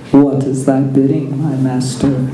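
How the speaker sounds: kneel and speak low to the master; Vader voice. A narrator's reading. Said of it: "asked the Dark Lord".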